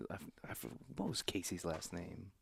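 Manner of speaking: ordinary talk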